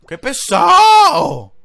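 high, loud, stretched-out groan of annoyance; drawn-out exasperated cry. Real, not acted. A streamer wailing at an enemy chasing him, long and fed up. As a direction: wail it long like you're fed up.

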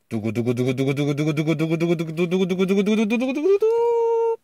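rapid drumroll rising steadily in pitch; vocal drumroll. Pretend. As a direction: build suspense with a rising mouth drumroll. Vocal drumroll before the winner.

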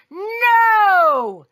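high, loud, drawn-out NO; yelled no. A narrator's reading. Father refuses the cashier, yelling. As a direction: yell the no and stretch it. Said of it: "The father yelled".

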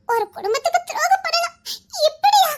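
rising through tears to a cry of hurt; tearful outburst. Acted. Wife confronting husband over betrayal, breaking into a tearful cry. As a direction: voice cracking into a sob on the last word.

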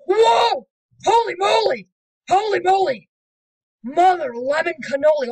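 loud high shocked yelling. Real, not acted. Screaming as the tractor gets demolished, shocked. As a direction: shout in real shock.

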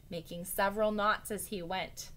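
ordinary delivery, nothing marked

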